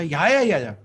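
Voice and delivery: loud, high run of yeahs; hasty assurance. Acted. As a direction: brush off the worry with eager agreement. Saint hastily placating the impatient woman.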